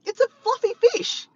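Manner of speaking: very high-pitched mock explaining; high, incredulous retelling; imitated exasperation. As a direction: mimic someone exasperated, way up high. Retold exasperated reply about the fish.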